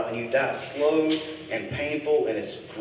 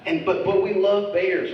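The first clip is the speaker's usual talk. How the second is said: ordinary delivery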